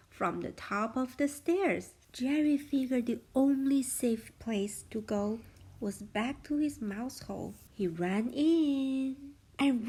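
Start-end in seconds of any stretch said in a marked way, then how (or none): none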